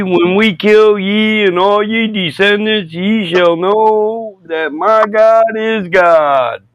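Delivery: high, slow, booming holy-warrior sermon; mock crusader preacher. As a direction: proclaim vengeance like a hammed-up zealot.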